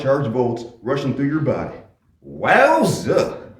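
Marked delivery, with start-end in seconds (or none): 2.3-3.5 excited yelp. Acted. Salesman zapped in electric chair.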